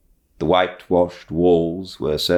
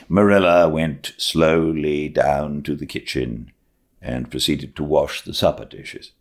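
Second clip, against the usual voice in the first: ordinary delivery, nothing marked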